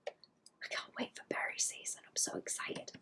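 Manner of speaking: excited breathy whisper to chat. Acted. Streamer whispering to chat about berry season. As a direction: hush it like a secret thrill.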